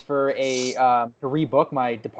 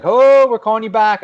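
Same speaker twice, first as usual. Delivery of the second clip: imitated caller; 8 semitones higher, 8 dB louder than usual. Scammer calling back, voiced.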